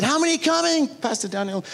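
high-pitched acted-out eager asking; eager question. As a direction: call it out high, then drop on the name. Acts out his eager question.

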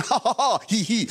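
light imitation of a driver's fixed laugh, three ha's then two he's; imitating John's laugh. Preacher imitating his safari driver's laugh, mild mock laugh. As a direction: lightly mimic the laugh, falling off at the end. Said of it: "he's going".

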